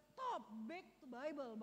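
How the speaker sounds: stop, back to Bible, each word drawn out slowly; slow emphatic command. Acted. Pastor commands the congregation back to the Bible, slow and weighty.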